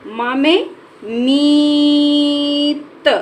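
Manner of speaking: मीत dragged out slowly; slow sounded-out word. Shown as a demonstration. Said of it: "बड़ी की मात्रा".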